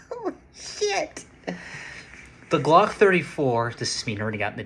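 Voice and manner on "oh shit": high, laughing disbelief; laughing oh shit. Real, not acted. Seeing how much longer the barrel is, laughing in disbelief. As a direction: laugh it out, amazed.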